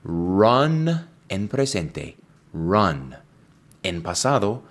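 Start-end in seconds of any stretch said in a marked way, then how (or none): 0.0-1.1 "Run" drawn out slowly as /rʌn/; slow clear run. Shown as a demonstration. Teacher modelling present tense for students, slow "Run". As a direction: stretch "Run" slowly so learners hear it. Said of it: "escucha la pronunciación muy atentamente".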